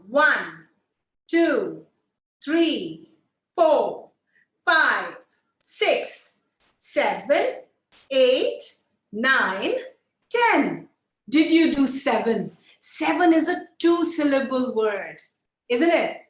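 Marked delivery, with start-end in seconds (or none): 0.1-10.9 loud, evenly spaced count; counting together. Shown as a demonstration. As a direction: call out each number on its own beat. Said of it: "We are counting one to ten".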